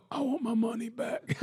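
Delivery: high, softened faraway yell; imitated disgruntled customer. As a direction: mimic a distant complaint from the crowd. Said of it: "you hear the dude go".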